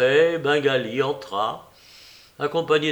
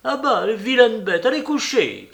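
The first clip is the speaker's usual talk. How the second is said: higher, louder scolding of the dogs; scolding cry; 8 semitones higher, 5 dB louder than usual. A narrator's reading. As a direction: shoo the beasts off loudly. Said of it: "criait sa maîtresse". (gronderie criée, plus aiguë)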